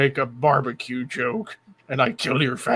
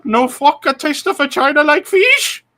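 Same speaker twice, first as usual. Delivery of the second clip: mock Chinese accent; 11 semitones higher, 5 dB louder than usual. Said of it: "the Chinese food bit".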